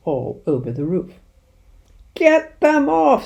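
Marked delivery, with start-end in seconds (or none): 2.1-3.3 slow, very high, loud scream. A narrator's reading. Mister Hazel screaming about birds on his Rolls, high panicked scream. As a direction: shriek in panic for his car. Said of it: "screamed Mister Hazel".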